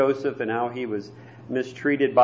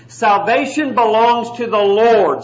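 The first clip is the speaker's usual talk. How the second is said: emphatic scripture quote; 5 semitones higher, 6 dB louder, slower than usual. Acted. Quoting scripture with force.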